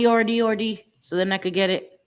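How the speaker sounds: ordinary talk, nothing marked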